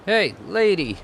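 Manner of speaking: loud, high street-punk hail; brash thug shout; thug calling out. Acted. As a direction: holler at her like a creep.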